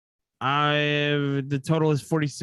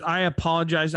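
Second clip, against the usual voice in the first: ordinary delivery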